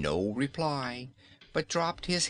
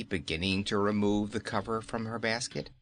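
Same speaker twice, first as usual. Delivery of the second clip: ordinary delivery, nothing marked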